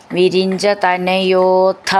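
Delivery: വിരിഞ്ച തനയോഥ slowly, with the ഥ firmly aspirated; firm aspirate. Shown as a demonstration. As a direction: lean on the ഥ at the end of തനയോഥ. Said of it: "ഥ എന്നുള്ള അക്ഷരം നന്നായിട്ട് ഉറപ്പിച്ച് പറയണം".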